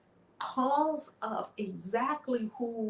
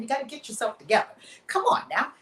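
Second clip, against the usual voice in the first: fast, louder, rising pep talk; brisk coaxing; 3 semitones higher, 7 dB louder, faster than usual. Retold or imitated. Witch urging the grieving king to pull himself together, brisk and loud. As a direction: hurry him back to his feet.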